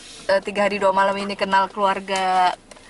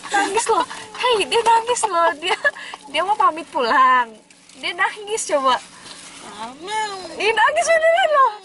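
playful teasing; 10 semitones higher than usual. Real, not acted. Teasing the crying guest.

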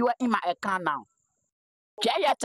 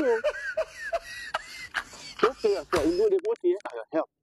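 normal talking voice

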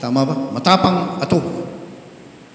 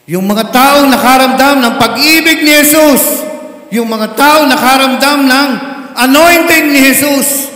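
fervent preaching; 5 semitones higher, 4 dB louder than usual. Real, not acted. Preacher building fervour.

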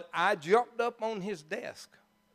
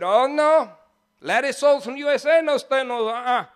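loud, high Trinidadian protest; broken-English Trinidadian imitation, raised voice; mimicked Trinidadian accent; 5 semitones higher, 7 dB louder than usual. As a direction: object as the Trinidadian old boy, outraged. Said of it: "I can mimic that old boy".

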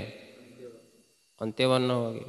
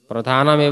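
louder, each word spelled out for dictation; emphatic dictation; 2 semitones higher, 7 dB louder than usual. Shown as a demonstration. Teacher loudly dictating the rule for students to write. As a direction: say प्रधानम एव firmly for students to write. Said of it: "क्या लिखा है?".

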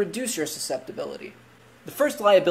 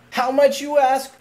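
higher and louder, playful rhetorical question; mock surprised question; 8 semitones higher, 6 dB louder than usual. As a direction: ham up the surprise as if asking for them.

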